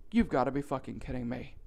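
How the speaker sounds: low, quiet disbelieving aside; low disbelief. Retold or imitated. Customer reacting to a shark-masked cashier, quiet disbelief. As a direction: drop it low and soft, in disbelief.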